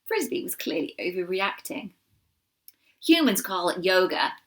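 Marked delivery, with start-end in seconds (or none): 3.0-4.4 a louder, easy American drawl; lazy American vowels, amused. A narrator's reading. American doe explains yoga to Shiloh, in an American drawl. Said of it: "in her American drawl".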